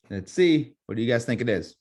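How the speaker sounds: ordinary talk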